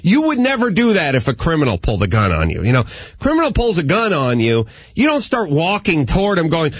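normal talking voice

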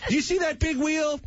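high-pitched mock rant to the kids; hammed-up father listing what he paid for; lecturing dad. Acted dad lecturing his kids on money.

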